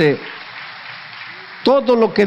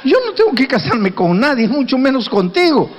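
indignant surprise; 7 semitones higher than usual. Acted. Woman's indignant retort.

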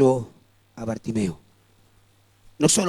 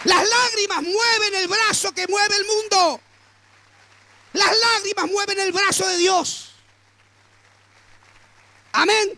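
preaching at full force. Acted. Preacher declaring to the congregation that tears move God, shouting. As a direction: proclaim it to the whole church.

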